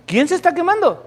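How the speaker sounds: high, alarmed asking; startled question. Retold or imitated. Teacher startled asking who is burning. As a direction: ask in sudden confusion.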